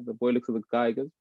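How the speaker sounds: ordinary talk, nothing marked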